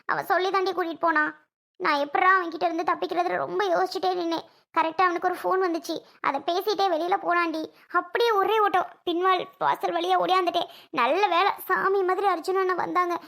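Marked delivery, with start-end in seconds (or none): none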